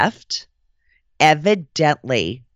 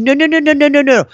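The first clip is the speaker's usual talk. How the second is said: high, rapid string of noes; dismissive interrupting; 11 semitones higher, 4 dB louder, faster than usual. Retold or imitated. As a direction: cut them off in a high mock-sweet refusal. Cutting off her excuse.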